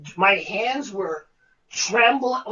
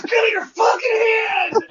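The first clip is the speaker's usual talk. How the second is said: much higher, louder furious demand; angry director; 11 semitones higher, 5 dB louder than usual. Retold or imitated. Director grabbing the actor's hand, furious yell. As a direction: really yell it this time. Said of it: "He goes".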